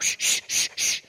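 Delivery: repeated emphatic shushes; shushing. Acted. Preacher acting Peter quieting the believers, emphatic shushing. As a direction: hush an excited crowd. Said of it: "Peter, quiet".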